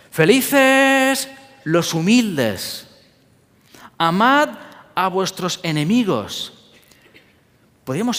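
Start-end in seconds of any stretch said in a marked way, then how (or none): 0.1-6.5 slow, raised proclamation; solemn scripture quote. Acted. Quoting beatitudes solemnly. (proclamación solemne y lenta)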